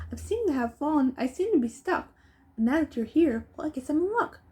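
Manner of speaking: lightly voiced hopeful boy; boy in a hole. A narrator's reading. Stuck boy asks the mayor, mildly hopeful. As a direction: plead politely upward. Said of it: "said the boy".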